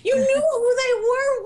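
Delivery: high, loud, near-crying protest; fan's anguished cry at the plot; exasperated outcry. Real, not acted. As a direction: wail it at the screen in disbelief. Exasperated outburst at the show.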